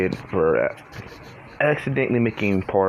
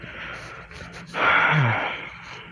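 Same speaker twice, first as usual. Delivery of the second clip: weary sigh; 7 semitones higher than usual. Real, not acted. Hiccups interrupting the recording.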